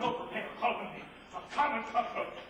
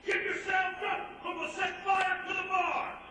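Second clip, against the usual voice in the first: commanding shout; 8 semitones higher than usual. Acted. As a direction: bellow orders at the barn. Soldier calling out a siege.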